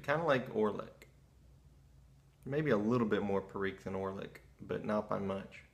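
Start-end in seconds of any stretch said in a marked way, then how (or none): 2.4-5.6 talking around pipe stem; pipe in mouth. Real, not acted. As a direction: lips clenched on pipe. Seen 0: Pipe stem held between lips in every labelled frame.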